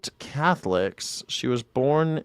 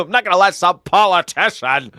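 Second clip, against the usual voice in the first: mocking quote; 5 semitones higher, 4 dB louder than usual. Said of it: "mocking her line".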